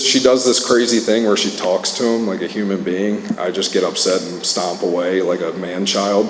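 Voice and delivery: ordinary delivery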